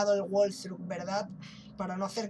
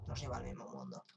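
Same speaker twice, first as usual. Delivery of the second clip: much lower, softer echo of the line; deep mock voice; 12 dB quieter than usual. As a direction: drop into a deep spooky echo. Repite con voz grave. (voz muy grave y burlona)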